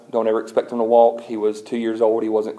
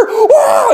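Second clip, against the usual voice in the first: high, loud joyful whoop; celebrating whoop; 9 dB louder than usual. Retold or imitated. Nannies cheering over the baby, high loud whoop. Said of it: "they're all screaming and celebrating".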